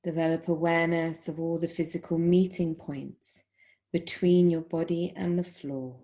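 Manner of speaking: calm, measured meditation voice with long pauses; guided Yoga Nidra. Acted. Yoga teacher guiding students lying in Shavasana, guided meditation. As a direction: guide them unhurriedly into stillness, phrase by phrase.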